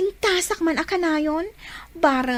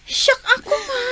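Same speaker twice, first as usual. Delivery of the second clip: giddy swoon; 8 semitones higher than usual. Acted. Swooning over Eddie.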